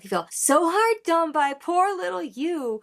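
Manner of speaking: high, slow mock sympathy; sarcastic. Reactor repeating the rapper's inflection, sarcastic. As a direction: draw it out with exaggerated pity. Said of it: "the inflection is reminding me".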